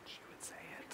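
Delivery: breathy unvoiced whisper; a secretive whispered aside. Real, not acted. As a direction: lean in and whisper the joke. Joking aside about wife.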